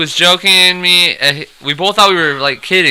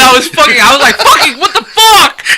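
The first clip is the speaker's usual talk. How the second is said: mad retelling; 10 semitones higher than usual. Retold or imitated. Caller relives being mad at the failed calls, high comic outrage. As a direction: squeal it out in mock outrage. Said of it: "Oh, I was so mad".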